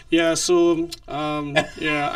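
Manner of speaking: low, unimpressed imitation of her; mock a sullen, uninterested co-host; resting bitch face. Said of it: "your bitch face, like, she's like".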